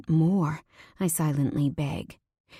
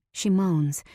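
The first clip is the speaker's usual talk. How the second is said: ordinary delivery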